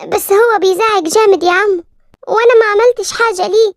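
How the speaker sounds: put-on a small girl complaining to her uncle, a little upset; scared little girl telling her uncle why she is upset. Narrator voices little girl.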